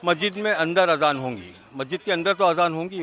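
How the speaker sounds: firm emphatic restatement; emphatic clarification. Real, not acted. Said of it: "पूरा बोलिए कि वो मस्जिद के अंदर होगी अजान".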